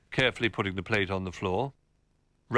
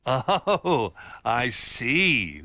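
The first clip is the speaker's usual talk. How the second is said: loud, higher, jolly realisation; amused chuckle; 4 semitones higher, 6 dB louder, much slower than usual. A narrator's reading. Old Bear catching on to Rabbit's confession, amused. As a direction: chuckle through the words. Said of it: "laughed Old Bear".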